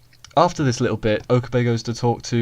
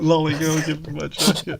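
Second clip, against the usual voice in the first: higher character quote; 6 semitones higher than usual. Retold or imitated.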